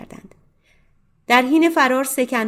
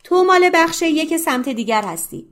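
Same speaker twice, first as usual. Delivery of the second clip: فریاد بلند و بالا; داد بزن تا اتهام را برساند; shout; 8 semitones higher, 5 dB louder than usual. Acted. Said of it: "فریاد زد". (فریادی بلند)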